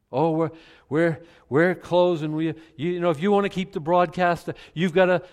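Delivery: mock TV preacher begging. Mocking pulpit beggars, pleading for donations. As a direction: ham up a begging broadcaster. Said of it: "People have done this from the pulpit".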